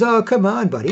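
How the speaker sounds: a hint of a wheedling old creep; creepy old man. Old pervert brushing off an objection at a party, lightly mimicked creep. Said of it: "This is a creepy, ugly old man".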